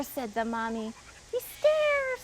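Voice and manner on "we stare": put-on zombie kids. A narrator's reading. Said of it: "said the three".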